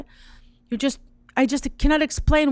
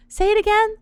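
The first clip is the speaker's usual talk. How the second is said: much higher, astonished request; stunned disbelief; 10 semitones higher than usual. Retold or imitated. Woman on a phone call, astonished and much higher. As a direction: gasp it out in disbelief.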